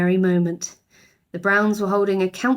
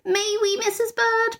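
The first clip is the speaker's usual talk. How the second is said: put-on pleading girl. A narrator's reading. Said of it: "pleaded Judy".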